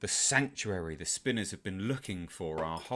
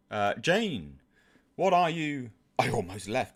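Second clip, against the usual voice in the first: normal talking voice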